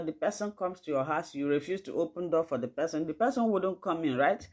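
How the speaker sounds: usual speaking voice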